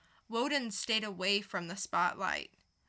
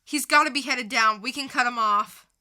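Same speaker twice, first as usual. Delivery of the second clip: urgent chase; 7 dB louder than usual. Acted. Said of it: "dramatic reading".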